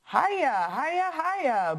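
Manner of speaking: high, hearty call; booming greeting. A narrator's reading. Grandparent arrives at the party, booming a hearty hello. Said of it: "boomed a familiar voice".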